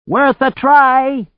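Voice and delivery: high, loud, stretched-out yell; shouted. A narrator's reading. Anne agrees to risk the launch, shouted. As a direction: shout it back, high and drawn out. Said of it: "she shouted".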